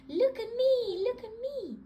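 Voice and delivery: put-on high, bright little girl voice; little pig girl asking her granny; Peppa. Little girl asking Granny for spooky fun, high.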